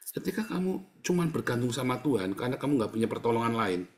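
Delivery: usual speaking voice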